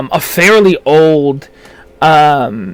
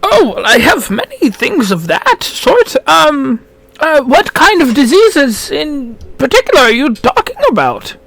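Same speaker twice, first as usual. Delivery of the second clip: put-on high, helpful old gnome voice; an eager shopkeeper gnome, pitched up; 10 semitones higher than usual.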